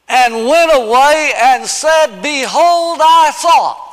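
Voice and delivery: building from a slow reading to a loud high cry; rising scripture proclamation. Acted. Preacher reading Naaman's words to the congregation, building to a cry. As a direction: read it slow, then cry out Behold I thought.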